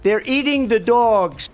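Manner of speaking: slow, drawn out and emphatic; hammed-up campaign rant; mock Trump rally voice. Mocking quote in commentary.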